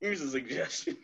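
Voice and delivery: high, soft, laughing app impression; cutesy suggestion voice. Twitter offering a user a suggestion, mock sweet.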